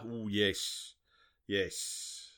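low, soft, savouring murmur; hammed-up suggestive undertone; mock lecherous. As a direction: drop low and quiet, relishing the memory. Savouring memory of a revealing dress.

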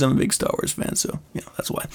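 hushed throwaway aside under the breath; whispered aside. Real, not acted. Telling listeners about Star Wars, a whispered aside. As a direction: drop to a whisper for the joke.

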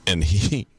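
usual speaking voice

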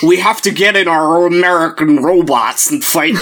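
hammed-up bad American accent, mimicking Charlie Hunnam's film voice; mock American accent. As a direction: parody a Brit straining at an American accent. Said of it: "Charlie Hunnam doing a terrible American accent".

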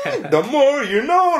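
high, slow public-service sign-off; sing-song like a TV info spot; hammed-up announcer, drawn out and high; mock PSA jingle. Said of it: "laying some knowledge on me".

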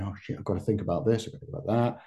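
hurried, hushed inner fretting; lightly acted quiet fluster; mock panic. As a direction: play a mildly panicked thought, quick and under your breath. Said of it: "'cause we're panicking".

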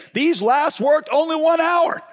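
high, slow, indignant grumble; grumbling complaint. Acted. Tired workers complain to the master, high indignant grumble. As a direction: whine it up high like a cheated worker. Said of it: "they grumbled at the master".

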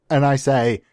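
normal talking voice

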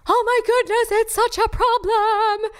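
high, slow, hammed-up fretting; over-the-top worried whine; mock panic.

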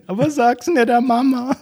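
put-on high, conspiratorial old-lady voice; mock granny whisper; old lady aside. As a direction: squeak it like a sly granny. Mimicking old woman's whisper aside.

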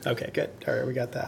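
hoarse, worn-out voice, almost gone; talking with a voice nearly lost to a cold; losing voice. Real, not acted. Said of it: "I have no voice in case you haven't noticed".

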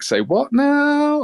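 high, slow, drawn-out "say what now"; stunned disbelief. Retold or imitated. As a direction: stretch it out in shocked disbelief. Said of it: "and I was like".

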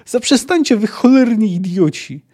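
exasperated, high-pitched shout; Jaskier yelling. A narrator's reading. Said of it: "wrzeszczał Jaskier". (podniesiony, wysoki krzyk)

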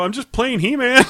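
high, loud imitation of being caught out; mock defensive protest. Husband caught playing explains to wife, mock defensive protest. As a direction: plead innocence, hammed-up and high.

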